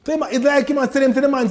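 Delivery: ordinary talk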